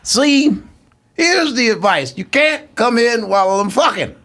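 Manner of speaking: mock Cosby scolding, high and drawn; Cosby impression. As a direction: play Cosby scolding a young comic. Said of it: "you be Cosby".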